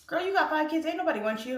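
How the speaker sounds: high, loud imitated critic; mock put-down. Critics dismissing a mother of five, mock high put-down.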